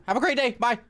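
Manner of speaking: high, sing-out farewell; over-nice goodbye. Pretend. Parting politely from game monster.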